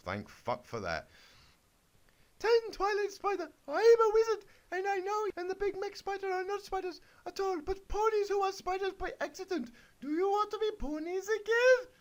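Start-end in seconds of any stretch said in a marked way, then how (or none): 2.4-11.9 put-on very high, piping girly voice; squeaky Miriam the wizard. Reading the kid's story, Miriam tells the spiders in a very high squeak. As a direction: squeak it like a little pony wizard.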